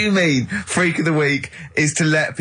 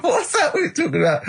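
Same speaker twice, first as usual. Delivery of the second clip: laughing; 12 semitones higher than usual. Real, not acted. Co-host laughing about the mum mix-up. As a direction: question squeezed out through laughter, higher.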